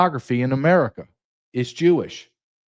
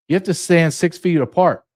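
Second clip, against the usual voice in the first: ordinary talk, nothing marked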